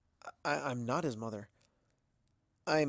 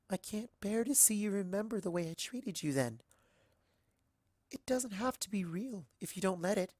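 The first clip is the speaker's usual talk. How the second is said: Stargazer's sweet plea; 8 semitones higher than usual. Acted. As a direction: beg her tenderly to stay in the dream. Stargazer pleading again.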